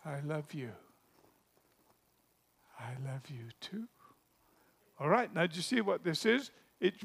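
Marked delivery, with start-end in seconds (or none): 0.1-0.7 shy murmur. Acted. Boy repeats love. 2.8-3.9 whispered, barely voiced reply; shy whisper. Shy girl whispers her love back to the boy.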